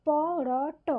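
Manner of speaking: পরট very slowly, pô-rô-ṭô, one letter after another; syllable by syllable. Shown as a demonstration. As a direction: stretch each open vowel in পরট: pô, rô, ṭô. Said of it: "সেভাবেই পরপর উচ্চারণ হবে".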